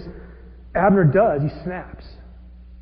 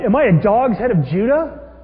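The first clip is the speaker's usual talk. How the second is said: insulted outrage; 3 semitones higher, 7 dB louder than usual. Retold or imitated. Abner retorting to Ishbosheth's accusation, loud and indignant. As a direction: fling the question back, offended. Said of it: "He's insulted".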